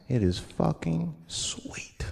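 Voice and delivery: slow whisper; whispered punchline. Acted. Comedian telling the crowd racism helped, slow whisper. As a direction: savor it in a whisper.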